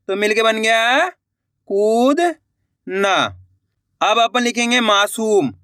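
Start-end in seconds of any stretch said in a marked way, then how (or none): 1.7-3.1 "कूदना" split slowly, long rising कूद, pause, then ना; drawn-out syllables. Shown as a demonstration. Teacher completing the word for learners, "कूदना" sounded out slowly in two parts.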